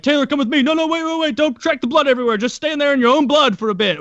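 frantic coach. Retold or imitated. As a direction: play the coach flapping over the bleeding kid. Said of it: "and they were like".

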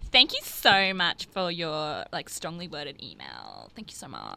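slow, soft, insincere gratitude; mock-polite thanks, fading away quietly; put-on saccharine thank you; bitchy schoolgirl sweetness. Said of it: "bitchy high school girl move".

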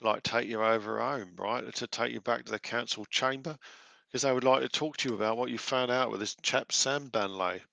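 cheery Cockney squire, lightly done; a chirpy Cockney lad greeting the party; a hint of Cockney; Cockney accent. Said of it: "With an accent like that".

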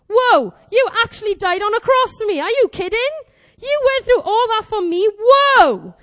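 loud, lifted awe building to a big whoa; amazed. Acted. Believer waking up amazed that God died for them, astonished.

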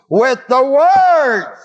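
slow down and land hard on "words"; stress on words. Shown as a demonstration. Teacher showing the class where the emphasis falls, stressed. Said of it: "with the words on the first emphasis".

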